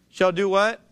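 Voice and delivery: ordinary talk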